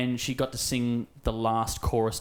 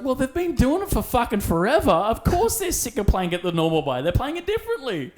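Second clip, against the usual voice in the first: high, loud exasperated mother explaining; exasperated mum; 13 semitones higher, 6 dB louder than usual. Retold or imitated. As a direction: lecture like a fed-up mum. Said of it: "And Mum's like".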